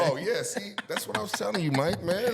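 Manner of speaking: laughing. Real, not acted. Laughing told-you-so.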